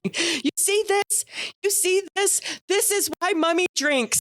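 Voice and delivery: high-pitched mock yell, no real volume; hammed-up, high exasperated rant; imitated yelling. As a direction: imitate a mother losing it in public. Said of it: "my sister starts yelling".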